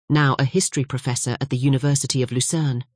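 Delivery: ordinary delivery, nothing marked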